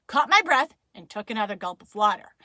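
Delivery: ordinary delivery, nothing marked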